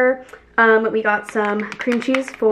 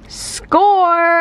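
triumphant cheer; 7 semitones higher, 4 dB louder than usual. Real, not acted. Triumph at finding pie.

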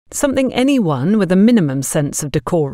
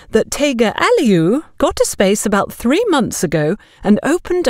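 higher, chattering gossip, speeding up at the end; gossipy busybody; 4 semitones higher than usual. Acted. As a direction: rattle off the gossip chain. Agatha relays gossip chain.

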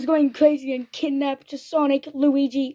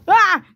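short loud, high yelp of alarm; play-acted high yell; mock scream; 6 semitones higher, 9 dB louder than usual. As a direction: scream as if suddenly grabbed. Character gets trapped in play.

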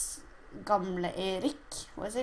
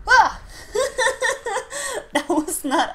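startled laugh; 12 semitones higher, 8 dB louder than usual. Real, not acted. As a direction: scream then laughter. Jump scare then laughing.

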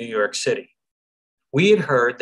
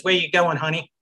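put-on higher-pitched New York accent; 5 semitones higher than usual. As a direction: put on a brassy New York accent, calling to a lost traveler. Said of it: "in her finest New York accent says".

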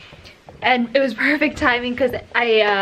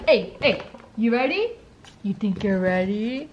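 ordinary delivery

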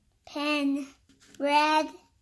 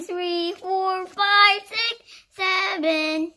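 loud, higher sing-song count; excited counting; 5 semitones higher, 8 dB louder than usual. Real, not acted. Counting the pups with growing excitement.

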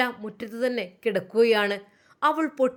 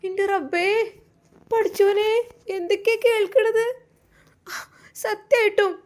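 high, alarmed cry of disbelief; shocked mother; 8 semitones higher than usual. Acted. Mother hearing her daughter struck her husband, high and alarmed. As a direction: gasp it out in horror.

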